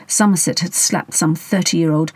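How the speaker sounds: plain reading voice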